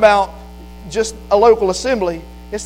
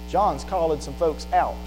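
ordinary delivery

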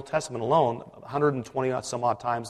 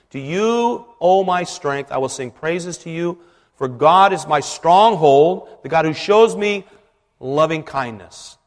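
loud, slow solemn reading; scripture reading; 3 semitones higher, 8 dB louder, slower than usual. Acted. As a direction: proclaim the verse with feeling. Reads the verse aloud.

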